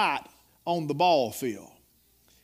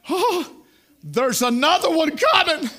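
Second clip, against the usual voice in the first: high, loud, laughing mock panic; mock dread; 11 semitones higher, 7 dB louder than usual. Preacher acting his boyhood self before the paddle, laughing mock dread. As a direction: squeal the boy's dread half laughing. Boy dreading the next lick.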